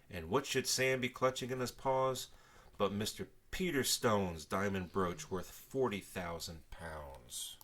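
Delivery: ordinary delivery, nothing marked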